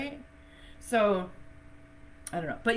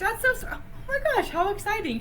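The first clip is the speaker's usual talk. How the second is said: much higher and louder, thrilled; delighted surprise; 5 dB louder than usual. Real, not acted. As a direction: react like great news just landed. Hears a viewer rescheduled for her.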